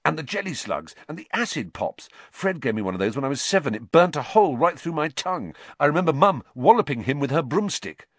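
high, loud, fast enthusiasm; eager boy. A narrator's reading. Ron showing Harry the sweets, excited. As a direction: rattle off favourites with relish.

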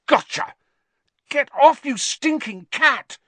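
triumphant angry yell. Acted. Ron grabs rat, fights cat.

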